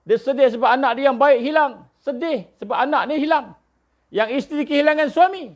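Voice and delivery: high-pitched urgent sermon delivery; impassioned preaching. Acted. (khutbah bersemangat, nada tinggi)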